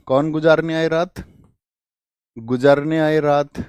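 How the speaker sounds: गुजरने आए रात said slowly, word by word; slow teacher drill of गुजरने आए रात; slow spelled out mnemonic. Shown as a demonstration. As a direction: break गुजरने आए रात into slow separate words. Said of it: "हमेशा बोलते हैं हम".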